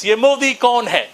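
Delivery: put-on fast, clipped British-accented Hindi; crisp British accent. Bengali comic mimicking his people's British accent. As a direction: rattle it off like a colonial gentleman. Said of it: "That is why we sound like this".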